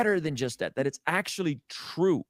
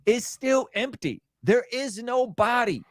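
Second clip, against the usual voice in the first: higher, slow and louder, every word driven home; fervent proclamation; 8 semitones higher, 3 dB louder, slower than usual. Real, not acted. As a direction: preach it like the tomb is truly empty. Insists on the resurrection in debate.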